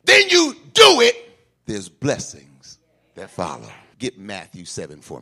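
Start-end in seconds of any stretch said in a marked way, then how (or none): 0.0-1.2 loud, higher shouted call; preaching at full force. Acted. Preacher calling on the congregation to talk back.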